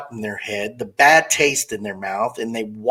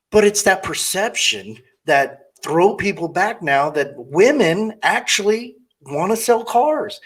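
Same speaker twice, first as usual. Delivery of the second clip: normal talking voice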